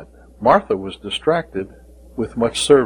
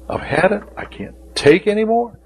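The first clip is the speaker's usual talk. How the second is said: exasperated sibling; 9 semitones higher than usual. Retold or imitated. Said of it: "You ever get frustrated with your siblings like that?".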